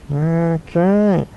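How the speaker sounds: character voice. Acted. Switching into Chewbacca puppet voice.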